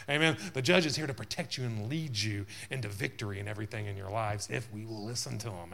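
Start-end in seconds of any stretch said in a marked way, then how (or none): none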